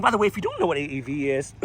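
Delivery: losing the voice mid-sentence; voice cracking. Real, not acted. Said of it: "sorry, I'm losing my voice".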